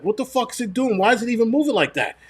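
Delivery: high-pitched exasperated questioning, not shouted; mad, retold. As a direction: replay your indignation with the pitch way up. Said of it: "I was so fucking mad".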